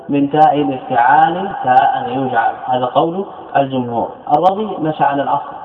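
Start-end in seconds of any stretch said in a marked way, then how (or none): none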